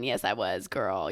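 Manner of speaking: lightly voiced sassy drawl; a hint of a goofy low voice; mock sass, half-joking; silly put-on voice. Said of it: "Why am I talking like this?".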